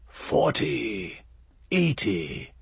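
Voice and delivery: eerie breathy whisper of an inhuman thing. Acted. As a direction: whisper the prices like a cold otherworldly being. The being bargains back.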